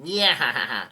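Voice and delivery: loud, hammed-up cackling "yeah"; yelled boastful laugh. Reviewer acting out Buggy's boast, loud mock villain laugh. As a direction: imitate a character's big crowing laugh. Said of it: "He yells out".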